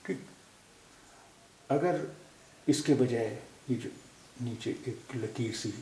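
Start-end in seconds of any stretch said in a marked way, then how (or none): none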